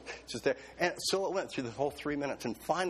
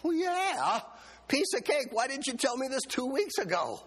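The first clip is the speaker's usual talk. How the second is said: high, eager burst, much higher; 11 semitones higher than usual. Retold or imitated. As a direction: jump at the easy task. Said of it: "And I go".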